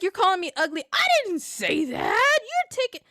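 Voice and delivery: high, put-on hurt tone; mock indignant accusation. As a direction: accuse him of insulting you. Retold argument.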